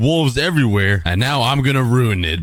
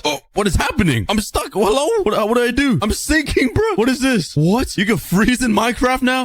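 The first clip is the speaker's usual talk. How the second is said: much higher, startled and confused; alarmed surprise; 11 semitones higher than usual. Real, not acted. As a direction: sound caught off guard and sinking. Sinking in powder snow.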